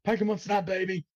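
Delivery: high, thrilled hype cry; excited cheer. Real, not acted. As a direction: cheer it like a fan. Gleeful game catchphrase cheer.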